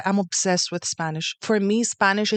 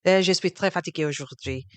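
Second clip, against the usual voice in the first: lower, unhurried French, like a tired tourist; French accent; 4 semitones lower than usual. Shown as a demonstration. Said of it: "Do French".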